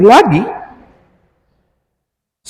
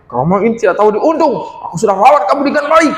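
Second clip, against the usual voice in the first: higher and fast, furious; angry sister; 7 semitones higher, faster than usual. Acted. Sister raging at her brother about caring for him, fast and high. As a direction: rattle off her grievance in rage. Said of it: "Dia bilang begitu".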